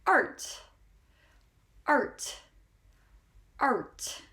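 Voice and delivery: "art" with a strong R before the T; stressed R. Shown as a demonstration. Teacher drilling learners on "art", R stressed before the T. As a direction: lean on the R in "art", then release the T. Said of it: "We stress that R before we pronounce the T".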